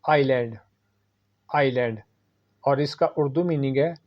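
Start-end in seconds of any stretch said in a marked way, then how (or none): 0.0-2.0 आइलैंड with a silent s, eye-land; correct pronunciation. Shown as a demonstration. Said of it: "सही इसकी pronunciation है".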